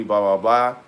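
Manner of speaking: normal talking voice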